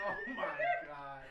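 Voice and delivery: ordinary talk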